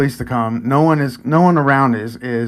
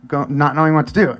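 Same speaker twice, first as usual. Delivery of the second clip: normal talking voice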